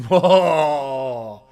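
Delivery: long, loud, drawn-out "whoa"; loud impressed whoa. Real, not acted. Streamer reacting to a national champion claim, loud amazed whoa. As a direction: belt out a long cheer of amazement.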